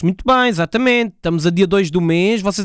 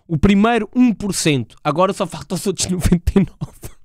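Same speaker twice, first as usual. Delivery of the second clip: normal talking voice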